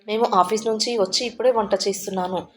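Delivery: ordinary delivery, nothing marked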